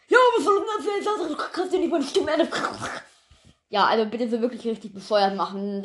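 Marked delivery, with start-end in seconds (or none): 0.1-2.5 high, silly dumb-guy voice; stupid voice. Acted. Sandra gives the character a stupid voice. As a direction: play it like a real idiot. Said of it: "richtig bescheuerte Stimme dahinter machen".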